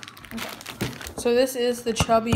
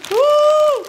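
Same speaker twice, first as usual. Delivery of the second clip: excited whoop; 9 dB louder than usual. Real, not acted. Cheering after his turn.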